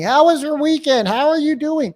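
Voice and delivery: high, loud, over-eager phone greeting; mock small talk. Shown as a demonstration. Agent wasting time on small talk, mock-eager and high. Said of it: "We don't waste time asking".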